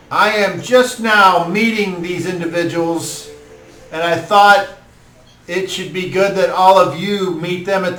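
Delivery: high, formal address to the crowd; lord's speech. Acted. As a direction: speak to the hall as host. Lord addresses the hall.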